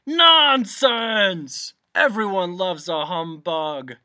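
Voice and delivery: loud, high blustering outburst; indignant shout. A narrator's reading. Humbug rebutting the bee's insult, indignant shout. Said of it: "shouted the humbug".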